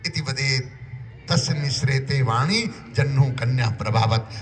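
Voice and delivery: low measured shloka; sanskrit verse recitation. Shown as a demonstration. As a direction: recite the verse quietly and evenly.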